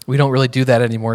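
ordinary delivery

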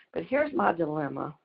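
ordinary talk, nothing marked

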